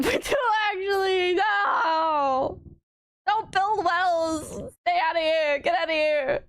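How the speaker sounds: half-laughing pretend scold; laughing mock rant.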